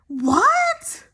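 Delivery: high stunned question; floored by what she heard; shocked. Real, not acted. Shock at the coma claim.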